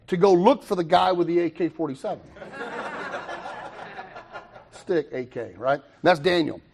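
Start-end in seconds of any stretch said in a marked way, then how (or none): 2.4-4.4 high laugh. Real, not acted. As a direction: burst of laughter. Audience laugh after joke.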